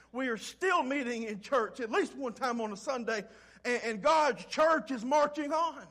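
full-force preaching. Acted. Pastor urging the congregation through Jeremiah's trial, preaching loud. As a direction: preach it hard and build.

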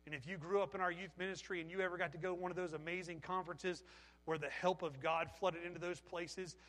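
normal talking voice

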